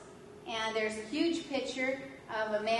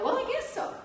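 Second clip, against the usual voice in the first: high, unsure answer; 14 semitones higher, faster than usual. Retold or imitated. Sheepishly claims to be saint.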